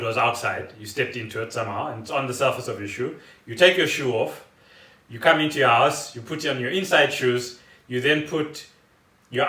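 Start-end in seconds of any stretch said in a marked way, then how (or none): none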